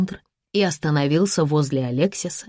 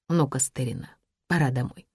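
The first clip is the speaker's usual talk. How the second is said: quiet; 3 semitones lower, 6 dB quieter than usual. Acted. William calming.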